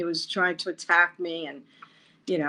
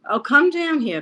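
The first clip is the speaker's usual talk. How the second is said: calling down; 9 semitones higher, 5 dB louder than usual. Retold or imitated. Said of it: "they called me downstairs".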